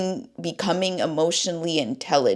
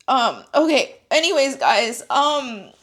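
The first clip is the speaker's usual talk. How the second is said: high voice, composing oneself after crying; tearful; 6 semitones higher than usual. Real, not acted. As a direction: wrap up while still tearful. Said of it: "because I'm crying".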